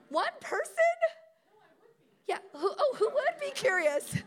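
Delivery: high, loud, laughing squeal; giggling incredulity; laughing disbelief. Real, not acted. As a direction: crack up at your own question. Laughing at her own question.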